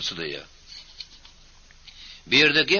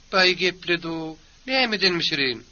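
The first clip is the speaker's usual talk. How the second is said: high, drawn-out sarcastic comeback; scornful wifely retort; 8 semitones higher, slower than usual. Acted. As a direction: raise the pitch and stretch it with scorn. Wife retorts to husband about dinner. (ýokary, süýndürilen kinaýaly ses)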